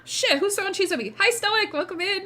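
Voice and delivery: ordinary talk, nothing marked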